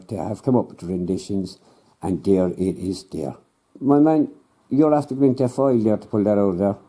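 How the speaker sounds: usual speaking voice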